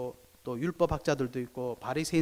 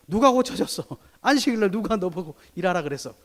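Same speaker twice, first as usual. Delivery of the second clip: higher, louder demanding question; mock interrogation; 4 semitones higher, 4 dB louder than usual. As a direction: demand who healed him. (다그치는 흉내)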